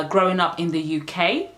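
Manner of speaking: ordinary talk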